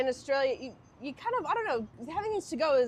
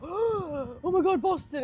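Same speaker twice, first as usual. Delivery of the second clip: slow, high, louder excited gasp; mock gasp of surprise; 7 semitones higher, 5 dB louder, much slower than usual. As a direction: imitate them gasping in delight, drawn out. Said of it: "they'd go, gasps".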